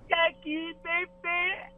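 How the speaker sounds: put-on high, childlike reply with a giggle; baby voice. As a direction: answer like a toddler called by dad. Baby-talk game repeated. (voz de bebê)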